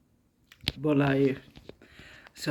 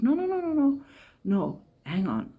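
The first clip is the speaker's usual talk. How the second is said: urgent no; 9 semitones higher than usual. Retold or imitated. Shopkeeper's urgent objection.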